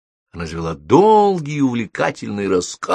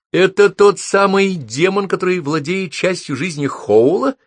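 incredulous; 10 semitones higher than usual. Acted. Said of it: "спросила Летти с недоверием".

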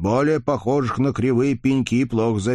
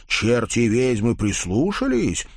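ordinary delivery, nothing marked

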